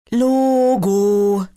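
loud, high blared "Logo"; trumpeting. Acted. Max answering his friends, trumpeting it out. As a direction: blast it out like a trumpet. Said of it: "trompetet Max".

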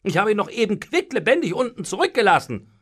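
high, loud astonishment; incredulous. A narrator's reading. Servant who just left the master alive, incredulous. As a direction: protest in utter disbelief. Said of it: "staunte Truffaldino ungläubig".

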